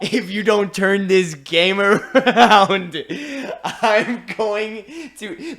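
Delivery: loud, slow, laughing mock threat. Commissioner threatening referee, loud slow mock threat. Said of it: "he was like".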